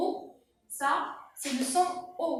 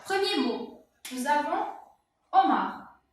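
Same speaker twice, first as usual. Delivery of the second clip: normal talking voice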